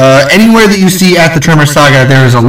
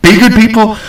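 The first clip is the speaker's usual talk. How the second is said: catchphrase sign-off; 6 semitones higher than usual. Retold or imitated. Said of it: "as they say on Dead Meat".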